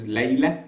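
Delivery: Arabic Layla ending on a faint breathy h; original Arabic pronunciation. Shown as a demonstration. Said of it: "the original is".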